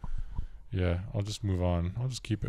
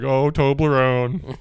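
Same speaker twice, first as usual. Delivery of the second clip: slow, drawn-out teasing call; mock teasing; 5 semitones higher, 6 dB louder, much slower than usual. Kids at school teasing Toby, drawn out. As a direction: stretch the name out to tease. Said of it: "we're just like".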